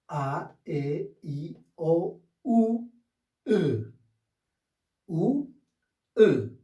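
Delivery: each vowel A, E, I, O, U said apart, clear and deliberate; vowel drill. Shown as a demonstration. Teacher naming the vowels for students, slow clear drill. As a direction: model the vowels one by one. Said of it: "vowel drill".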